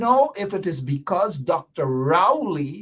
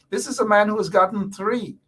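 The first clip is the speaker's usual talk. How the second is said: usual speaking voice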